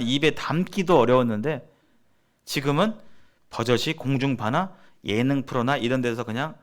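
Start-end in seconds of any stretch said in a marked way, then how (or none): none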